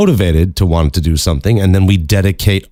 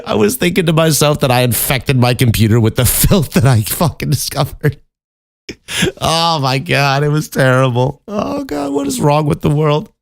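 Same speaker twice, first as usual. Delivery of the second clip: high voice, words breaking into laughter; real helpless laughter, pitched up high; laughing in disbelief; 7 semitones higher than usual. Real, not acted. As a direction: confess it while cracking up. Laughs helplessly confessing the search.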